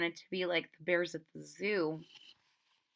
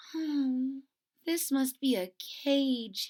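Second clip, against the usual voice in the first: lightly voiced little bear, puzzled; Corduroy's voice; 4 semitones higher, slower than usual. Bear peeking through basket bars, puzzled.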